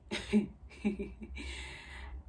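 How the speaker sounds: breathy giggle mid-story; stifled giggle at her own story; amused, breathy laugh. Real, not acted. Laughs while telling her story.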